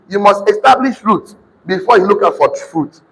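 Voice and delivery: usual speaking voice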